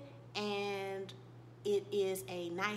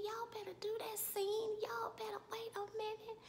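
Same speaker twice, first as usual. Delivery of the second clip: high, soft, thrilled little voice; hushed squeal; 11 semitones higher, 5 dB quieter than usual. Retold or imitated. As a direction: gush in a tiny high-pitched voice. Swooning praise of the scene.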